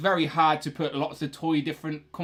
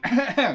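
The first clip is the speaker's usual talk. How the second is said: real coughing fit from spicy food; choking cough; 6 semitones higher, 4 dB louder than usual. Real, not acted. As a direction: cough and splutter. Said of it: "Sorry, I'm choking".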